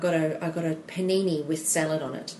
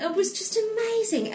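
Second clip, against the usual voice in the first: excited; 14 semitones higher than usual. Real, not acted. Raving about San Francisco.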